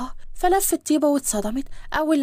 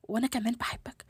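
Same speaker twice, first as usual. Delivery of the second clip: very soft, shy-sounding love answer; tender reply; 3 semitones lower, 10 dB quieter than usual. Acted. Bride answering her beloved's confession, softly and tenderly. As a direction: answer hushed, just for one person.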